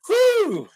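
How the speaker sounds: loud, high "whew"; excited whoop. Real, not acted. Reacting to the start of the sex scene, excited whoop. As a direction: let out a big whoop.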